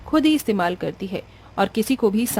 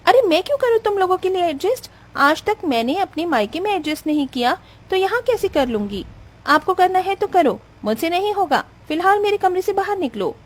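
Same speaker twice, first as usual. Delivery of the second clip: higher, sharp scolding; angry scolding bride; 8 semitones higher than usual. Acted. Bride scolds mother-in-law to leave, higher and sharp. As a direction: shame her and order her out.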